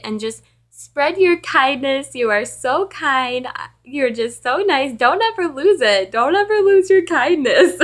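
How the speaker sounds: hammed-up earnest plea, growing loud and giggly; gushing colleague imitation. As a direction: imitate a lady piling on praise. Imitating colleague's overdone praise.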